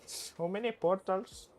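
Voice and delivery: high, slow mock Indian accent; goofy Indian accent. Streamer still clowning about the portals, silly Indian accent. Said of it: "I just do an Indian accent".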